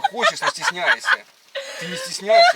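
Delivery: teasing through a high laugh. Acted.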